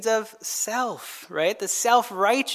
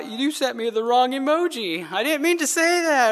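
rising, higher-pitched mock protest; mock upset; 4 semitones higher than usual. Texters squabbling over the wrong emoji, mock upset. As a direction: imitate someone fretting over a text. Mimics a texting misunderstanding.

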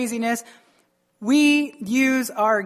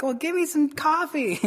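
higher, mock-pleading comeback; playful retort; 6 semitones higher than usual. Husband answering wife's nagging about water, mock-pleading. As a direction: plead comically, pitched up.

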